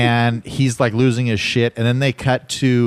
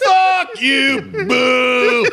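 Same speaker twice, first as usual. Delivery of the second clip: put-on high-pitched drunken heckle; mimicked heckler scream; much slower than usual. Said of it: "She just starts screaming".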